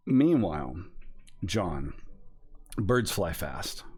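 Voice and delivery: ordinary delivery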